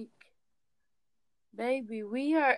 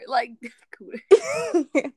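laughing; 2 semitones higher, 5 dB louder, much slower than usual. Real, not acted. Laughing at the word cooter.

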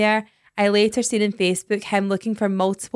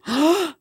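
mock gasp; 7 semitones higher than usual. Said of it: "then go back and go".

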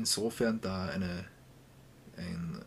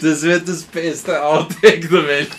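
excited; 6 semitones higher, 16 dB louder than usual. Real, not acted. Excited about outtake.

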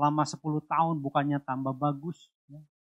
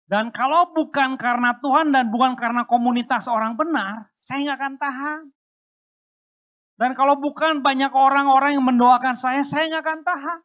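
higher, much louder testimony; fervent sermon cry; impassioned preaching; 8 semitones higher, 9 dB louder than usual. Acted. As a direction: preach it with full force. Preaching at full force.

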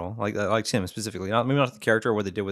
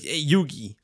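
higher Brooklyn dub voice calling out; mock Brooklyn; 6 semitones higher, slower than usual. Cohost imitating the Yu-Gi-Oh dub in a Brooklyn accent. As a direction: call out like a cartoon Brooklyn kid. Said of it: "It's like".

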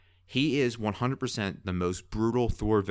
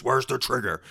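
Batman voice; 5 semitones higher, 4 dB louder than usual. Retold or imitated. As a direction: gravelly growl of Batman, a bit higher and louder. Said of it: "they think the Batman voice".

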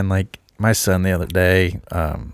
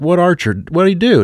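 high, raised questioning voice; retold high-pitched parental query; alarmed parent asking; 10 semitones higher, 4 dB louder than usual. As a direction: ask the child in exaggerated concern. Father questioning his small daughter.

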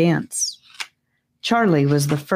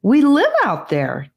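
put-on eager boy; 9 semitones higher than usual. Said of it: "Gabe tried to explain".

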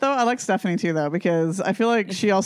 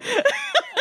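high laugh. Real, not acted. Laughs at Spencer quote.

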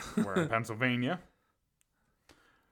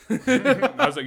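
slow, much higher, loud laughing; real laughter; 14 semitones higher, 13 dB louder, much slower than usual. Real, not acted. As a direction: crack up mid-story. Bursts out laughing retelling stripping.

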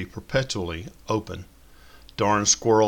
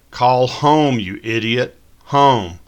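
much louder, higher, exasperated; frustrated shout; 5 semitones higher, 10 dB louder, slower than usual. A narrator's reading. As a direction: yell the command at the dumb phone.

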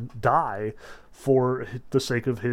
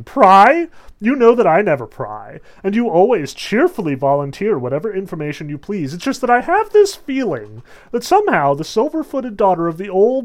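loud, higher mocking tirade; sarcastic furious Hera; 4 semitones higher, 8 dB louder than usual. Hera sarcastically accusing Zeus of siding with Thetis, loud. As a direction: pile sarcasm on him, loud. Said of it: "Hera is not taking any of this shit".

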